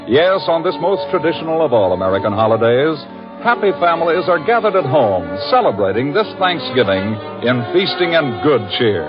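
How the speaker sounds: normal talking voice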